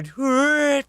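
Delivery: sudden high, loud shout. Shown as a demonstration. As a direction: yell it out high. Demoing a sound, shouting.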